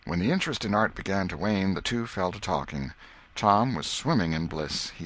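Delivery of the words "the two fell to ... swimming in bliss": plain reading voice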